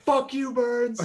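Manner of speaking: high-pitched mock far-off shout; shout in the background. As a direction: mimic someone yelling from the back of a recording. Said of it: "a little bit in the background being like".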